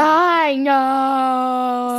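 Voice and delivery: loud, long-drawn exasperated groan of words; mock exasperation. Cutting off a friend's explanation, loud mock exasperation. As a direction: stretch KNOW out loudly. Irritated at being corrected twice.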